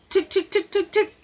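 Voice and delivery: high, light tick sounds of a phone dial; mock phone dial ticks; imitated dialing. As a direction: click out the dialing with your tongue.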